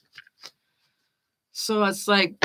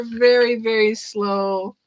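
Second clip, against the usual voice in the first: stretched out, very slowly; drawn out slowly. Shown as a demonstration. Reader teasing the earth signs, drawn out slowly. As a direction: drag the words out slowly. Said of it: "but they're slow as all hell".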